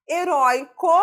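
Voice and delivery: "Heróico" with RÓI high and loud; stressed syllable demonstration. Shown as a demonstration. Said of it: "sílaba tônica".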